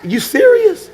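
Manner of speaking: jumping up high on serious; a husband faking amazement at his wife's story; mock astonished. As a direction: play the shocked listener. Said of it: "And say".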